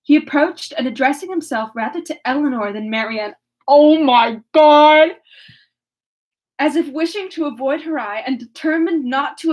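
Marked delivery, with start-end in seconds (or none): 3.7-5.1 drawn-out, loud "oh my God"; shocked aside. Real, not acted. Reader breaking off mid-chapter in shock, exclaiming. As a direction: stop dead and react loudly, stretching each word.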